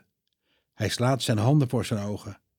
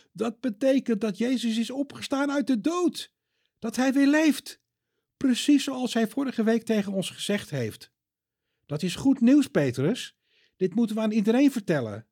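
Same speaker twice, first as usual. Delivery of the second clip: higher, bright excited voice; overflowing with good news; joyful John; 12 semitones higher than usual. Acted. John tells Peter Jesus is alive, excited higher voice.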